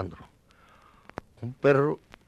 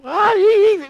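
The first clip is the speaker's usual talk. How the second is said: startled cry; 12 dB louder than usual. Retold or imitated. Sudden fright in retold scene.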